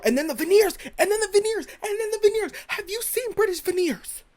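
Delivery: high, loud comic outrage; worked-up disbelief. Real, not acted. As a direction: pitch it way up, repeating in escalating disbelief. Mock outrage over British veneers.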